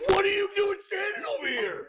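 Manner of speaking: high, strained screaming tirade; mock furious meltdown shout; hammed-up losing it. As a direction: impersonate a star screaming at the crew. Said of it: "dude losing it on the light guy".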